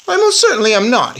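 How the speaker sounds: loud, high protest; indignant shout. Acted. Bruno shouting at the narrator that he is not a mouse. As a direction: Bruno yells his denial. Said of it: "he shouted".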